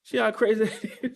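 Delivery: incredulous repeat broken by a laugh. Real, not acted. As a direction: laugh through the words.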